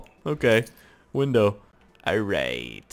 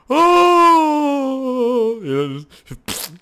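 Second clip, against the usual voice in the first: mock surprise; 9 dB louder, much slower than usual. Imagined fall down stairs.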